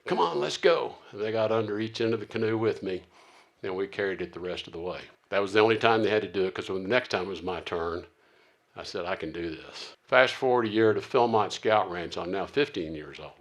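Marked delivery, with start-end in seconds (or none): 0.1-0.9 high-pitched rallying call; cheering on. Retold or imitated. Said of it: "gave me a little cheering session". 9.1-9.9 low, soft self-assurance; quiet resolve. Retold or imitated. Retold resolve after earlier tearful refusal.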